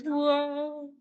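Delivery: mock whoa; startled whoa. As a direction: gasp it out startled. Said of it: "She goes".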